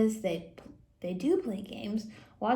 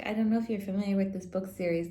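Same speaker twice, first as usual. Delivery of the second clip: plain reading voice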